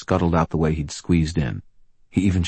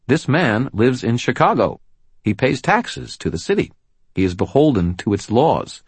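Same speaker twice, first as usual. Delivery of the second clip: raised, commanding lawwoman voice; firm policewoman; 7 semitones higher, 4 dB louder than usual. Acted. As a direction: stand your ground, loud and firm. Murphy argues citizenship.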